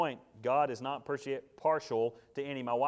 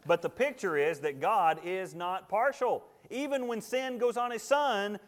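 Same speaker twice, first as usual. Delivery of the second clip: higher, louder fervent sermon; full-force preaching; 7 semitones higher, 6 dB louder than usual. Acted. Preacher hammering God's impartiality to the congregation, louder and higher. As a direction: drive the point home to the pews.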